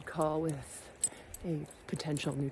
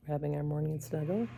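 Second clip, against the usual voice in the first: normal talking voice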